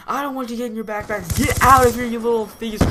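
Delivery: high, fast scolding building to a shout; angry teacher. Retold or imitated. Teacher throwing out accused thieves, hammed-up angry yell. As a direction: imitate a teacher's furious outburst.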